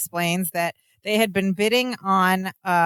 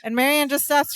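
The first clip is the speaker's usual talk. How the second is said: ordinary talk, nothing marked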